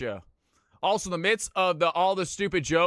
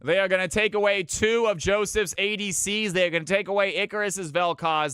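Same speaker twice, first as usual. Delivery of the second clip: draft caster; 5 semitones higher, 5 dB louder than usual. Acted. Draft casting.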